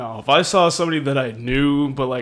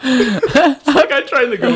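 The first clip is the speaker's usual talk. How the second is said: laughing loudly; 12 semitones higher, 11 dB louder than usual. Real, not acted. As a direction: laugh through it. Awkward escape, laughing.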